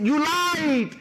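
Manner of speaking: a hint of an angry prospect; mock angry accusation. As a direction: play the offended prospect, pitch up. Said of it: "he gets angry with me and says".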